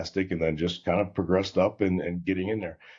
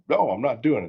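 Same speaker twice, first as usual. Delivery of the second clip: retold firm no, louder and higher; mad refusal; 5 semitones higher, 5 dB louder than usual. Young technician turning down his service director, mad refusal. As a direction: push back hard, as a retold refusal. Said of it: "I'm kinda mad".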